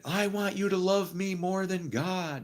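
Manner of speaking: high-pitched slow imitation of the wife yelling; hammed-up wife shrieking her demand; wife in an argument, imitated as a high slow mock scream. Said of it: "And she's screaming that".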